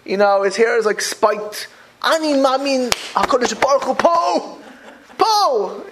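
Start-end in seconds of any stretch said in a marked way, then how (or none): 2.0-5.8 mock-fervent, high proclamation. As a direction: act out a zealous believer, high and punchy. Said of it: "they talk like that".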